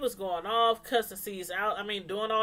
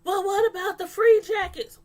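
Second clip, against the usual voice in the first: put-on much higher, louder nagging girlfriend; imitating the girlfriend interrupting about jackets; mocking Donna; 10 semitones higher, 7 dB louder than usual. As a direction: butt in with a bratty whine. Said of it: "Donna and her big mouth".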